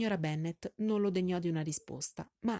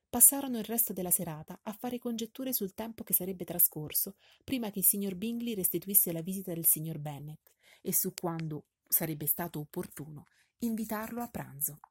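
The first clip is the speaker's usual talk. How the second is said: usual speaking voice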